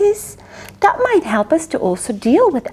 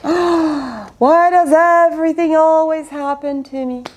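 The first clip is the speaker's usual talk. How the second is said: whining self-pity; 3 semitones higher than usual. Acted. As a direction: gasp then long wail. Winston's self-pity with gasp.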